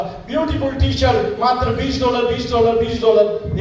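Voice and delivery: high-pitched mock vendor's cry; imitated street hawking. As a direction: call out wares in repeated bursts. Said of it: "जोर-जोर से चिल्लाने लगा". (फेरीवाले जैसी ऊँची पुकार)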